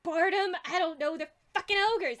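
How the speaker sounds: high, loud irritation; indignant high-pitched outburst; exasperated goblin. Acted. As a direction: snap back loudly at a silly question.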